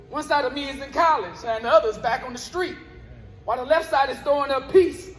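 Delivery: rhythmic, punchy spoken word delivery, words hit on the beat; spoken word poem. Acted. Poet performing his piece for a live crowd, rhythmic spoken word. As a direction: perform it like a slam poet on stage.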